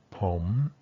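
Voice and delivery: "pom" alone, rising from low; low rising "pom", then a break; isolated rising tone. Shown as a demonstration. Teacher shows tones in isolation, "pom" low and rising. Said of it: "There's like a little break".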